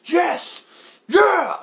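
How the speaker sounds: high, loud whoops of approval; excited cheering. Retold or imitated. Moviegoer reenacting his reaction to the fight, loud cheer. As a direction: cheer wildly at the screen.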